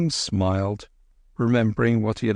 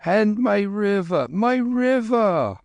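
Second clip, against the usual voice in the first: put-on high, slow, pleading old man; 12 semitones higher, slower than usual. A narrator's reading. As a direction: plead for his lost river. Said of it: "pleaded the Lama".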